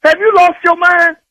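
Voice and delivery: high-pitched, outraged challenge; real heated indignation; angry confrontation. Real, not acted. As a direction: square up to him, pitch climbing. Husband quarrels with the doctor.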